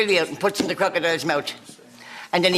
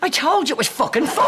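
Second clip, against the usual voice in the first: high, laughing mock outrage; playfully indignant; teasing exasperation; mock annoyance; 10 semitones higher than usual.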